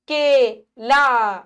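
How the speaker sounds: केला stretched very slowly; teacher stretching केला; slow model word. Shown as a demonstration. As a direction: draw out केला for children. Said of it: "क्या बनेगी?".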